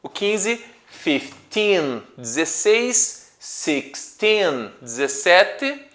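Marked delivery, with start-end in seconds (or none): 0.9-2.0 put-on fifteen with a long, stressed "teen"; "fifteen" split, held on teen. Shown as a demonstration. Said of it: "com o teen na sílaba mais forte". 3.4-4.7 put-on sixteen with a long, stressed "teen"; "sixteen" split, held on teen. Shown as a demonstration. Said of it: "com o teen na sílaba mais forte".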